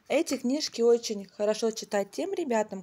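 ordinary delivery

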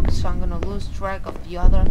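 ordinary delivery

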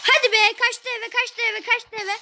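high, excited yelling at a fleeing enemy. Real, not acted. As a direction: keep pitch high and urgent. Teammate's enemy fled, excited.

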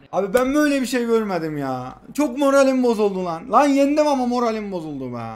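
usual speaking voice

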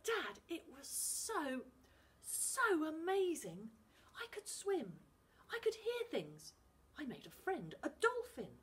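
put-on excited boy begging to be believed; earnest boy. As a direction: pour the story out to Dad. Finn explains the sea.

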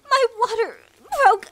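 high, loud cry of alarm; alarmed, strained shout; panicked cry. Acted. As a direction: cry out in sudden pain and shock. Water breaks while trapped.

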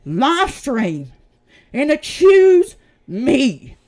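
loud, slow, angry accusation, each word split off; furious. Real, not acted. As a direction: hammer out every word in real anger. Furious accusation during live stream.

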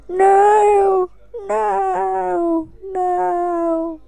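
mock despair over the lost character; mock anguished cry. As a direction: wail it like a hammed-up tragedy. Character dying in game.

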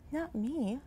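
hushed little objection; whispered. A narrator's reading. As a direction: say it softly, like a timid whisper. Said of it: "whispered Snake".

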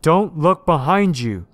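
loud, high, drawn-out warning; shouted warning. A narrator's reading. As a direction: shout it slowly and urgently. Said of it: "shouted the policeman".

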